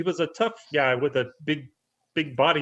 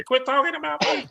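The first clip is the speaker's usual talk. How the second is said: annoyed host voice; 11 semitones higher than usual. Retold or imitated. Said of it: "jump back on here ... and say".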